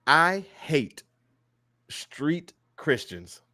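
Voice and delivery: "I hate street Christians" slow, each word set apart; very clear, emphatic. Real, not acted. Host declaring his rant to the audience, "I hate street Christians" slow and deliberate. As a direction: spell out "I hate street Christians" slowly, with pauses between words. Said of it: "make this very clear when I say this".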